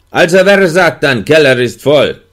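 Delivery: höher und lauter gerufen; Frage durch die Tür. A narrator's reading. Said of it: "stellte die Frage durch die Tür". (Wärter fragt durch die Tür, laut)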